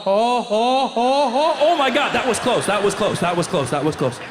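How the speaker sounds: excited peak sinking into spent repeats; orgasmic excitement. Acted. Comic mimicking a soccer fan near a goal, orgasmic excitement fading. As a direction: swell on the ohs, then let it die down.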